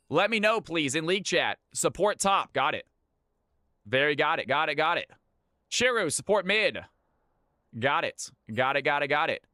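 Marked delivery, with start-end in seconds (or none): none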